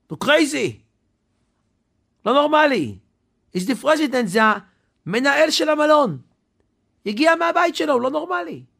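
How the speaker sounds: loud, high, agitated outburst; a night guard loudly venting about his boss; guard ranting. Retold or imitated. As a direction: throw up your hands and shout that he's crazy. Guard rants about the hotel manager.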